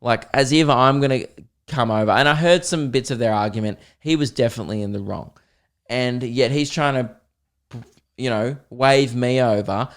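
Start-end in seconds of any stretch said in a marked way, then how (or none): none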